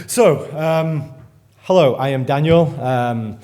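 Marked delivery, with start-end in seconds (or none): none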